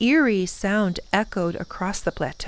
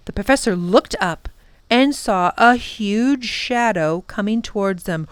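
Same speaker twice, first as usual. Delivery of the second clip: ordinary delivery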